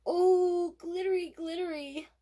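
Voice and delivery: put-on a small child marveling at sparkly nails; delighted little boy. A narrator's reading. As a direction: squeal it with wonder, then trail off. Toddler delighted by his nails.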